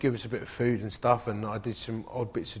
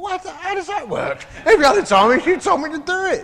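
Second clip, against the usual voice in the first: much higher and louder outraged protest; indignant protest; 12 dB louder than usual. Retold or imitated. Protesting to his wife Karen, loud and high indignation. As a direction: throw her own advice back at her.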